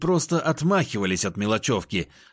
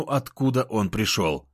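plain reading voice

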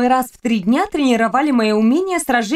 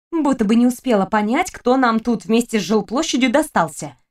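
usual speaking voice

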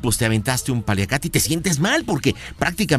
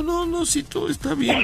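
much higher hammed-up teary voice; mock crying; 13 semitones higher than usual. Host playing the guilty girlfriend, high hammed-up mock sobbing. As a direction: fake soap-opera tears, pitched way up. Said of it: "así llorando".

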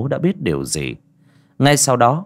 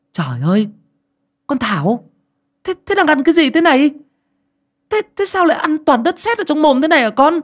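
shrill high panicked scream, louder; mother screaming; 5 dB louder than usual. A narrator's reading. Mother screaming at her daughter eating clay, high and loud. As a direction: scream in horror at the child. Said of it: "mẹ hét ầm lên".

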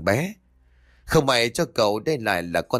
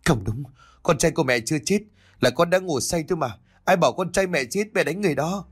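higher, pleading denial through tears; tearful denial; 6 semitones higher, faster than usual. A narrator's reading. Mother denying her son's death, tearful and rising. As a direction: refuse the truth, voice rising and tearful. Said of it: "nói trong nước mắt".

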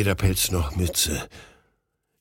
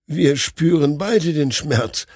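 higher, slow, dazed realization; shaken doctor; 6 semitones higher, 3 dB louder, slower than usual. A narrator's reading. Doctor telling his friend they both feel the pain. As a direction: state it in stunned wonder.

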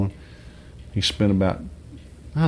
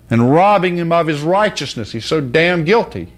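preaching at full force; 8 semitones higher, 5 dB louder than usual. Real, not acted.

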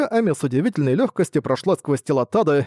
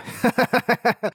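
demon laugh; 9 semitones higher than usual. A narrator's reading. Demon laughs after speaking.

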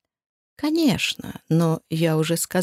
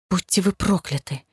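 helpless rage. A narrator's reading. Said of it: "простонала в бессильной ярости".

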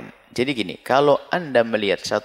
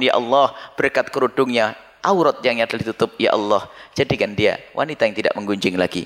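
gentle prayer; 4 semitones lower than usual. Acted. Voices a quiet prayer.

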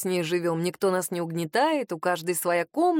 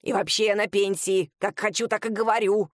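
put-on cranky old lady voice; old woman voice. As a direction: voice a stubborn grandma. Grandma character voice, far timbre.

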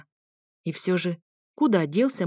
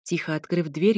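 usual speaking voice

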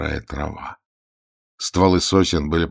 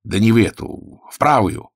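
raised voice, impatient correction; insistent, higher-pitched correction; hoarse inhuman correction; 5 semitones higher, 5 dB louder than usual. A narrator's reading. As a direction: correct him as the unseen inhuman voice. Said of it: "поправил голос".

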